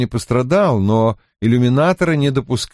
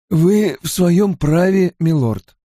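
higher, slow deferential retainer; simple, matter-of-fact; 7 semitones higher, slower than usual. A narrator's reading. Hurin accepts his lord's apology, simply and deferentially. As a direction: state it simply, as a loyal servant. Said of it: "просто сказал Хурин".

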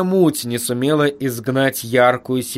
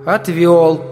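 lightly voiced demon servant report; reporting back; 3 semitones higher, 3 dB louder than usual. A narrator's reading. Demon reporting to his master, dutiful. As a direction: announce the task done, slightly raised. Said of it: "доложил Шраххан".

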